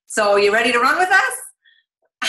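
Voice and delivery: slow, upbeat, assured ask; bright confident invite, sure of the yes; confident and positive. Retold or imitated. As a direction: assume they're already in. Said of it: "come from that position of confidence".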